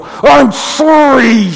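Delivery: loud, high, dragged-out sulky sorry; a child forced to apologize, hammed up; fake apology. Kid mocking parent's demand for an apology, drawn out and high. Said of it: "is that a real apology?".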